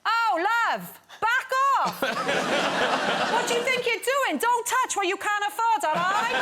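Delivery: very high, loud, brassy put-down; Nessa telling him off. Retold or imitated. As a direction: slap the cheeky fan down hard. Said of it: "because I was Nessa".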